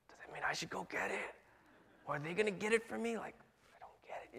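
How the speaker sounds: soft unvoiced whisper to yourself; a dinner guest's confused inner whisper; whispered thought. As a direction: whisper the doubt so the host can't hear.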